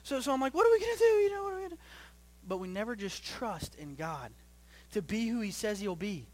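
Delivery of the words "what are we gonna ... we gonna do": high, louder panicked wail; mock freaking out. Parent retelling reaction to sick child, mock freaking out. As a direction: send up your own parental panic. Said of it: "but I'm freaking out".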